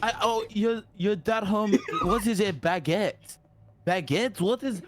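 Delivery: baguette questioned in a puzzled French caricature; mock French bafflement. French friend feigning confusion at baguette, mock French accent. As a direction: puzzle over baguette as if never heard. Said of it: "And she's like".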